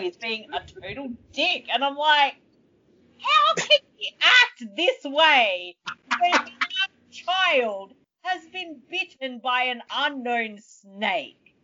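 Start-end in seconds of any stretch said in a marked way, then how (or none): 3.2-11.5 high, slow disbelieving plea; incredulous outrage. Acted. Viewer appalled at leader ignoring snakebitten child, aghast.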